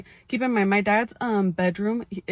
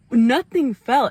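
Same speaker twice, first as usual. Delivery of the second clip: louder punched nothing fell; loud emphasis; 4 semitones higher, 7 dB louder, slower than usual. Shown as a demonstration. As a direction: hit nothing fell hard.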